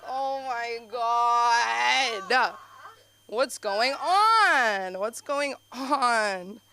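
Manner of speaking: very slow, stretched out in disbelief; drawn-out shock. Retold or imitated. Reacting to her mom on shaving, stretched-out shock. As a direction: let each word hang in stunned amazement.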